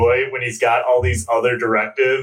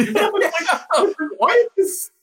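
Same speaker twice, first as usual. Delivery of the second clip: high, laughing incredulity; 14 semitones higher, slower than usual. Real, not acted. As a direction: laugh through the question. Laughing at the movie.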